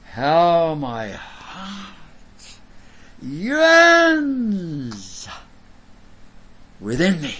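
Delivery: theatrical cry from the heart; impassioned yearning. Acted. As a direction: swell into a drawn-out cry of longing, then drop back. Acts out Job's heartfelt longing.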